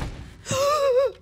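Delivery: sharp high loud gasp; high shocked gasp; startled gasp. Real, not acted. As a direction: gasp at the sudden fright. Sudden scare.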